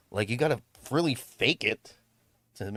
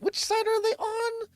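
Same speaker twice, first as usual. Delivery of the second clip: much higher, lost little voice; confused self. Retold or imitated. Streamer voicing their own confusion at the trial in a much higher voice. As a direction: sound totally lost. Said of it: "I'm like".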